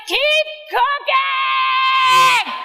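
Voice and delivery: chef's shout. Retold or imitated. Gordon yelling at the cook to keep going, drawn-out high shout. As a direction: bellow it like a chef who won't let up. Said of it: "And he's like".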